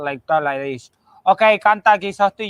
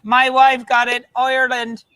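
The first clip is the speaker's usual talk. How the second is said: usual speaking voice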